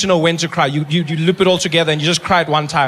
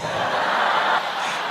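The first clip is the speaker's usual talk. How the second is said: 1 breathy laugh; 5 dB quieter than usual. Real, not acted. Laughs at own punchline.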